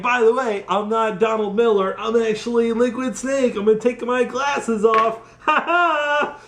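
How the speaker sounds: hammed-up villain unmasking himself; mock villain reveal. Voicing villain's gloating reveal.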